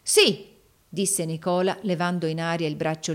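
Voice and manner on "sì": loud, high emphatic yes; a raised, forceful retort; defiant. A narrator's reading. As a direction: throw it back at them defiantly. Hero defies the villains.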